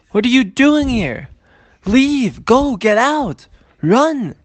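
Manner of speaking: high, loud, drawn-out commands; rebbe screaming. Acted. The Baal Shem Tov ordering Shmulek out of shul, screaming. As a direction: shout each order to drive him away. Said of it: "keeps screaming at him".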